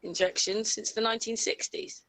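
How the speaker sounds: ordinary talk, nothing marked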